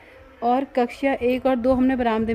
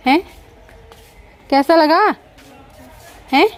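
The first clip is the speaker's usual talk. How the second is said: louder, higher repeat of the question; 7 semitones higher, 10 dB louder than usual. Real, not acted. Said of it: "कैसा लगा पृथ्वी?". (ज़ोर से दोबारा पूछना)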